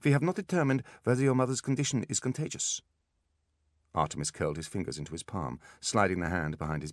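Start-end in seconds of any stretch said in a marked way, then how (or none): 0.0-2.8 put-on high-pitched German accent, no apology; brusque German-accented refusal; unsympathetic German doctor. A narrator's reading. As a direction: warn him off in a German accent. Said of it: "without a trace of apology or sympathy".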